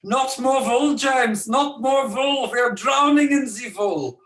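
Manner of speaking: put-on high, loud wee-boy voice with a German accent; shrill-pitched German-accented protest, mock outburst; [name], German accent. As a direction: wail it like a small German boy. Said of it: "wee boy voice, sporting his native German accent".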